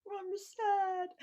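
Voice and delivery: put-on a little boy admitting he is sad; a tiny sad child voice; small child. Child answers parent.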